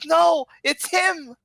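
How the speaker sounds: put-on high-pitched kid crying out, slow and loud; shouting child. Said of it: "one of the kids shouts out".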